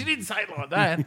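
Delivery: imitating his partner's high retort; mock partner's retort. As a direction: pitch it up as the nagging partner. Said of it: "She goes".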